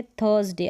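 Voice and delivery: थर्सडे in two syllables, r left silent; correct THURSDAY pronunciation. Shown as a demonstration. Teacher introducing the word to learners, थर्सडे with silent r. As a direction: say थर्सडे as "thuhs-day". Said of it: "थर्सडे पढ़ेंगे".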